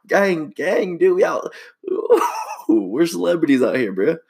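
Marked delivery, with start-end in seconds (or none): 1.8-2.6 high squealing laugh; giddy laugh. Real, not acted. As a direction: burst into a high giggle. Laughing at his own bravado.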